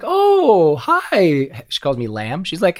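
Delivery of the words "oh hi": put-on high, lilting little old mother greeting; imitating his Polish mom saying hello; mom's voice. Son retelling his mother's surprised hello. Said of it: "this little Polish woman with this really heavy accent".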